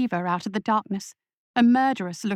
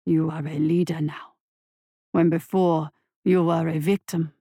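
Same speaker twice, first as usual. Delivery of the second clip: monster voice; 5 semitones lower than usual. A narrator's reading. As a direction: purr approval like a monster. Monster lectures boy.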